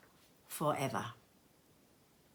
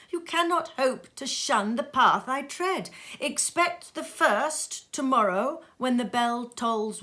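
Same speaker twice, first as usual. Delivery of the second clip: a higher, louder voice for the ghost of Marley, solemn and warning; the dead partner's grave warning, raised and intoned; Marley's ghost; 7 semitones higher, 6 dB louder than usual. Acted. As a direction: ghost voice delivering a dire warning, pitched up. Ghost foretells the spirits.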